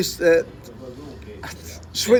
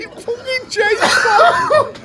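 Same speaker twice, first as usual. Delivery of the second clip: high, loud, slow shouting through laughter; real laughter over a shouted line; laughing shout; 5 dB louder, slower than usual. Real, not acted. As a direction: yell it while cracking up. Retelling chaos through laughter.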